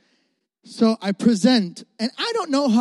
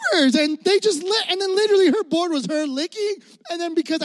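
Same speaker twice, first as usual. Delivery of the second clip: mocking disbelief; 10 semitones higher than usual. Mocking rival's lollipop project.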